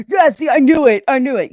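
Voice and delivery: loud, high gloating imitation; mock triumph. Woman mimicking a man she just conceded to, mock triumph. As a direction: crow it like you were proved right.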